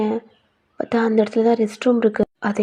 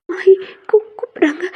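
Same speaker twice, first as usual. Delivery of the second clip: panicked; 7 semitones higher than usual. Acted. Heroine startled, someone calls her.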